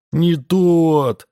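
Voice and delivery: put-on a small boy bawling in protest. A narrator's reading. Boy rejecting the wrong ball, high loud child's cry. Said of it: "заплакал мальчик ещё громче".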